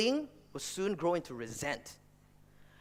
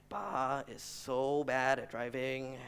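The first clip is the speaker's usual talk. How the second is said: muttering brother; 5 semitones lower, 7 dB quieter, much slower than usual. Retold or imitated. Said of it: "He's just muttering to himself".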